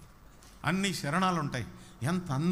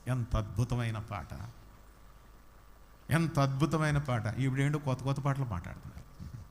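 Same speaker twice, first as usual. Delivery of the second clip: usual speaking voice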